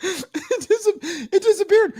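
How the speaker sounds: very high, choked disbelief; hysterical squeal. Real, not acted. Streamer mourning vanished stream, hysterical. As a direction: squeak it out, barely recovering from laughter.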